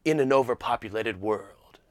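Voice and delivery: deep dramatic trailer narrator; old movie trailer voice. Retold or imitated. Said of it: "the old movie voice".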